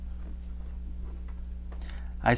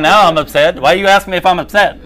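loud, higher-pitched indignant outburst; mock upset; 5 semitones higher, 8 dB louder than usual. Snapping at someone who asked if you're upset, acted annoyance. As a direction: act exasperated, pushing louder and higher. Acts out getting upset at question.

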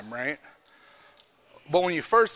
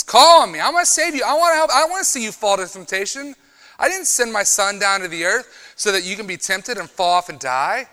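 higher, louder, pleading voice of God calling back; high, earnest voice of God answering; God's reply; 7 semitones higher, 6 dB louder than usual. Acted. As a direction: speak as God eager to rescue. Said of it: "He says".

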